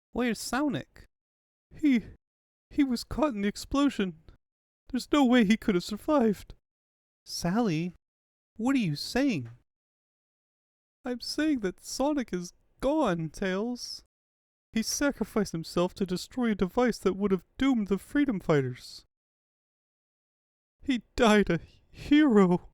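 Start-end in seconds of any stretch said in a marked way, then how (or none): 0.1-14.0 high, halting grief; Sally telling Tails that Sonic is gone; grieving. Acted. Sally reports Sonic's death. 20.8-22.7 slow, louder and higher tribute; Sally honouring Sonic, slow and loud; solemn grief. Acted. Eulogy for fallen Sonic.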